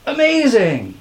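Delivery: high, amazed exclamation; high cry of amazement. A narrator's reading. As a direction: exclaim it in wonder. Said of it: "cried Culkin".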